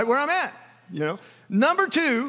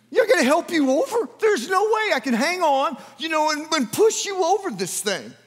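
alarmed thought; 7 semitones higher than usual. Retold or imitated. Inner panic at the wall.

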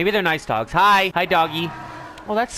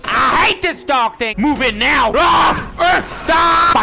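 raised voice climbing into screams; frantic shouts mid-fight; battle yelling; 6 semitones higher, 5 dB louder, slower than usual. Real, not acted. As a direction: yell at the thing attacking you.

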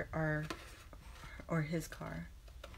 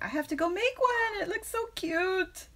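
gushing; 12 semitones higher than usual. Retold or imitated. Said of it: "I was like".

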